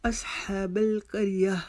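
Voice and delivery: slow असहाबल करिया ending in a breath of h; measured chant, करिया closed with h; stop on h. Shown as a demonstration. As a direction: recite असहाबल करिया and pause on final "ah". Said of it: "हा की आवाज़ में निकालेंगे".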